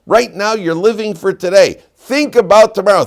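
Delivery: high, loud, insistent appeal; urgent exhortation. Acted. Aaron urging the people to consider tomorrow, urgent exhortation.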